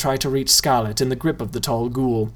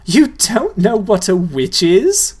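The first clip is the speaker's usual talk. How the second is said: put-on scornful girl; 7 semitones higher, 5 dB louder, slower than usual. A narrator's reading. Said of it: "asked in a way that made Nobody's cheeks feel hot".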